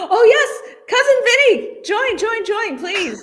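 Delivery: high, thrilled welcome; excited. Real, not acted. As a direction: greet him with delight. Excited greeting of a guest.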